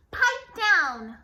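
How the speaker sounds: loud sharp command; stern bark. A narrator's reading. Strict teacher silencing kids, loud bark. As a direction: snap at the class. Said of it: "said the swamp".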